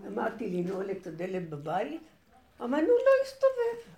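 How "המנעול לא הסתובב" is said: high strained complaint, stuck lock; frustrated high whine. Retold or imitated. (תלונה גבוהה ומתוסכלת)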